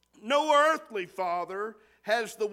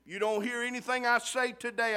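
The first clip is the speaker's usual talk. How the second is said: usual speaking voice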